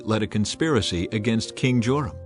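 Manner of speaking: ordinary delivery, nothing marked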